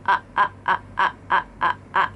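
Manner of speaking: quick separate ah bursts; short glottal pulses. Shown as a demonstration. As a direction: tap out each ah with the throat. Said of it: "you make this sound".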